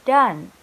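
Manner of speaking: Done, short uh, said loud; model done. Shown as a demonstration. Teacher modelling Done for the class. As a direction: bark out Done like dun. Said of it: "repeat after me".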